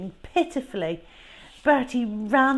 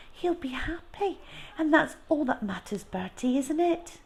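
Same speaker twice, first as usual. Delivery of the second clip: mother coaxing; 9 semitones higher than usual. Acted. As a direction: talk him round gently, lilting up. Mother voiced.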